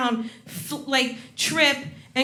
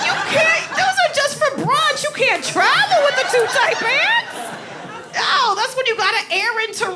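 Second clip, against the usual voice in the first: much higher, louder mock-outraged preaching; high comic rant; 11 semitones higher, 4 dB louder than usual. Comedian warning about tight pants, high mock outrage. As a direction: squeal the warning at the audience.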